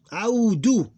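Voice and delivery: Audu high and loud, "au" then separate "du"; two syllables. Shown as a demonstration. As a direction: sound out Audu with a short final "du". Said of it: "Two different syllables".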